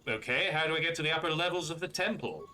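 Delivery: ancient database computer voiced in a Boston-New Jersey accent; a talky Boston-New Jersey computer, faintly put-on. Ancient database greeting Revan, in a lightly done Boston-New Jersey accent. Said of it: "doing the same accent for everyone".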